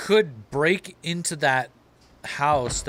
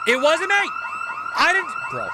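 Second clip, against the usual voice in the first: panicked denial. Real, not acted.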